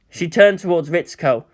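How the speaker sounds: plain reading voice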